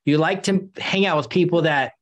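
ordinary delivery

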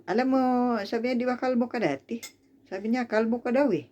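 high, mocking jab with a sneer; taunting tone. Mimicking the relative taunting her about being bald, high and mocking. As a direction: needle her on purpose, high and snide. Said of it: "iba talaga yung tono niya".

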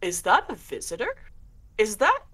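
a hint of a curious spirit; lightly voiced eerie stranger; unseen voice calling. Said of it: "you hear someone go".

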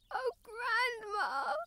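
slow, crying plea to grandma; tearful cry. Acted. Girl crying out to her grandma in despair. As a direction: let the tears break through. Gerda despairs over lost Kai.

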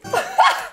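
short high-pitched burst of laughter; high cackle. Real, not acted. Streamer laughing at mistaking the song, high cackle. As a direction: crack up in one high cackle.

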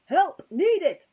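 loud, high alarm call; urgent call. Acted. Microphone alerting the toys, loud high call. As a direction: sound the alarm.

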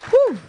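high breathy whew after the poem; relieved whew. Real, not acted. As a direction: let out an overwhelmed whew. Reacting after moving poem.

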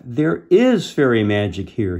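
a touch of excitement, lifted volume; excited cry. A narrator's reading. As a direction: read it as a boy's amazed discovery. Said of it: "he cried".

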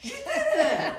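high excited cheer. Real, not acted. Magic trick success, cheering.

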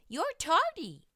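high, loud stern teacher voice; scolding teacher. Acted. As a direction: scold him like a strict teacher. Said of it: "David's teacher always said".

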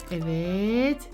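normal talking voice

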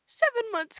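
high, loud disbelieving cry; stunned yell of disbelief; shocked outburst. Acted. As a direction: blurt it out in shock.

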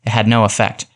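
ordinary delivery

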